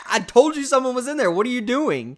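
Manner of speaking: retold indignant protest, pitched well up; imitated exasperation. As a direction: act out an exasperated "I told you so". Acting out David's exasperated scolding.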